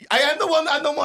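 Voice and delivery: very high, breathless admission; hammed-up nervous witness; panicky high answer. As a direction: blurt it out scared. High panicked reply to cop.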